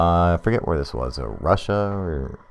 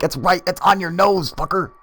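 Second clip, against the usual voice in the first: very high, strained imitation of hollering; mock exasperated yell; 13 semitones higher, 3 dB louder than usual. Friend directing a man to the light, mock yelling. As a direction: holler it high, at wits' end. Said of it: "his friend is yelling at him".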